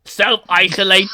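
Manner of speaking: loud, slow robot command; drawn-out mechanical order; Dalek. Retold or imitated. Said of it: "that video of that Dalek".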